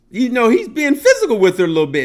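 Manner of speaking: ordinary delivery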